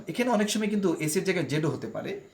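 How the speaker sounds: ordinary delivery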